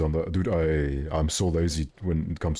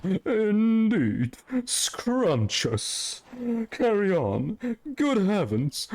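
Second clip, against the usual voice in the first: much higher, slow mock posh British voice; hammed-up British accent; 14 semitones higher, much slower than usual. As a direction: overplay a prissy Brit at the peak of it all. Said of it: "would sound something like".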